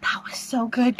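breathless whisper of delight; awed whisper. Real, not acted. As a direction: whisper it, stunned. Reacting live to trailer.

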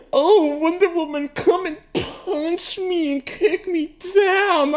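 exaggerated lovesick squeal, drawn out slowly; mock swooning plea. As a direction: ham up a starry-eyed fan begging to be punched. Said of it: "And I would be like".

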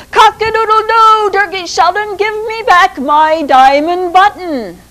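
high, loud rooster crow and demand. Acted. Rooster calling up at the Sultan's window, high and loud crowing. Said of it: "he cried out again".

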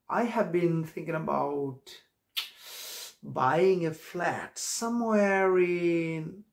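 very slow English, stretched words; drawn-out example. Shown as a demonstration. Teacher using flat in an example, very slow. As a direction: draw the sentence out, trailing upward. Slow model sentence for learners.

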